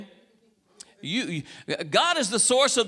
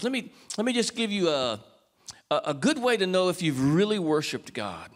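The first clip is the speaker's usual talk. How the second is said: ordinary talk, nothing marked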